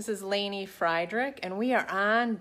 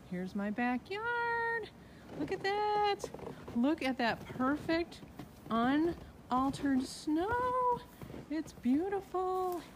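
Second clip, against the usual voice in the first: hushed awe; 6 semitones higher, 7 dB quieter, slower than usual. Real, not acted. Admiring untouched snow.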